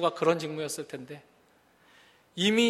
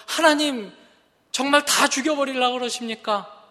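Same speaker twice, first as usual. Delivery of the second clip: higher-pitched, pleading protest to God; anguished outcry; 6 semitones higher, 3 dB louder than usual. Acted. Ezekiel protesting to God over Israel's destruction, anguished outcry. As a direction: cry out in desperate questioning, moderately raised voice. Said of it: "라고 절규하는 거죠".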